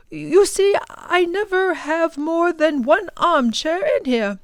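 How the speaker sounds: a fussy scholar being courteous; light old gentleman voice; genial professor. Acted. Professor voice held.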